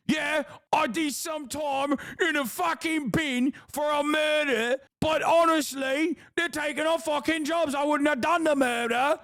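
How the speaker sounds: high, shouted mock yob; mock EDL lad. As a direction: bellow it like a clueless thug. Mocking the EDL guy again.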